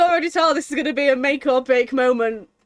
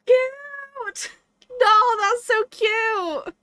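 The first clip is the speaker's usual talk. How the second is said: high-pitched gushing squeal; excited squeal; 11 semitones higher, much slower than usual. Real, not acted. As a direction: gush over something adorable. Streamer gushing over game character.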